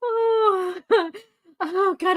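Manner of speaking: high, winded from laughing; teary laughter. Real, not acted. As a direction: catch your breath after laughing. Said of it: "I'm, like, in tears right now".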